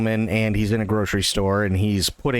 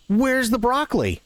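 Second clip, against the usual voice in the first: lady customer asking; 11 semitones higher than usual. Retold or imitated. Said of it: "And she goes".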